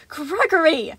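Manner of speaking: high, loud calling of the name; scolding. A narrator's reading. Sun scolding Gregory by name. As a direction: snap his name sharply. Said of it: "he scolded".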